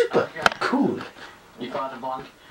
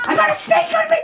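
panicked squeal; faster than usual. Real, not acted.

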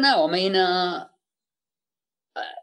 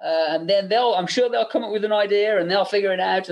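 raised, insistent boss voice hinting at one person; higher-pitched mock of a pushy producer; imitating Bob; 6 semitones higher than usual. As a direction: lean on the room with pointed urgency. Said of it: "he kept saying".

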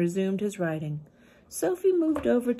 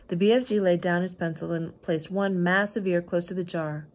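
usual speaking voice

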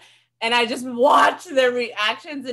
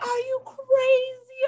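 shocked mom; slower than usual. Retold or imitated. Mom scolding daughter.

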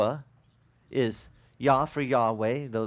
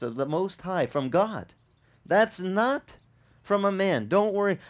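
higher, forceful preaching voice; preaching with conviction; 6 semitones higher than usual. Acted. Angel assures doubting Joseph, higher forceful preaching. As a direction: drive it home like a sure messenger.

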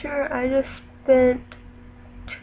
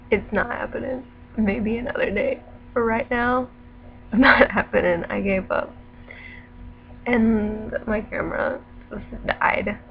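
ordinary talk

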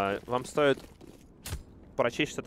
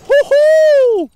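high, loud whoop of excitement; excited whoop; 19 dB louder than usual. Real, not acted. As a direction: whoop like a hyped caster. Hype at the play.

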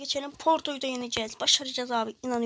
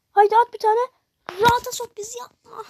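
bağırarak; 8 semitones higher, 7 dB louder than usual. Real, not acted. Oyuncuya bağırarak gol istiyor.

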